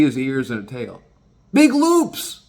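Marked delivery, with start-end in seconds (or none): 1.5-2.4 high, loud burst of amazement; animated storyteller's high-pitched emphasis; excited exclamation. Real, not acted. Exclaims over dog's huge circles.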